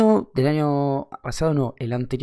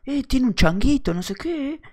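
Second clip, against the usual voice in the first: high-pitched put-on lad calling out; mock voice of a pestering stranger; imitated street kid; 12 semitones higher than usual. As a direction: pipe up like an excited passer-by. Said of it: "y dicen los pibes".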